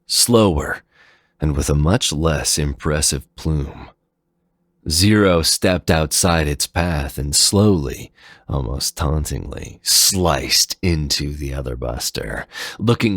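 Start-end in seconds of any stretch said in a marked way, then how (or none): none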